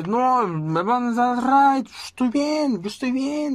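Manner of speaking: put-on a drunk kid insisting he's fine; drunken slurring. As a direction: drag every word out like you're wasted. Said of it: "arrastraba mucho las, las palabras".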